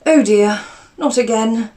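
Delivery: an aunt's weary "oh dear"; dismayed aunt. Acted. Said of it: "she replied".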